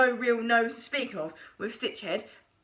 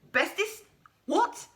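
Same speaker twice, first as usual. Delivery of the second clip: higher startled squeak; 7 semitones higher than usual. A narrator's reading. Stitchhead alarmed at being called a friend, startled squeak. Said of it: "squeaked Stitchhead".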